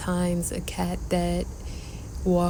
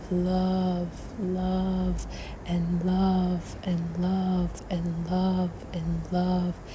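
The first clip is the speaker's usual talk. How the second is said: guided meditation. Acted. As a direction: guide the breath calmly. Leads a breathing meditation.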